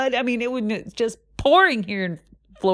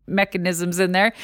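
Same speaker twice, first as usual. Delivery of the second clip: usual speaking voice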